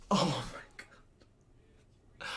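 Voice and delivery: slow, drawn-out whisper; whispered disbelief. Real, not acted. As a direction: breathe it out, stunned. Disbelief after penalty call.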